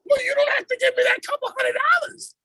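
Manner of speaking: very high, whiny mock wife voice; hammed-up high-pitched wife. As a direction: squeal it like a wife dodging paying. Voicing wife's whiny excuse.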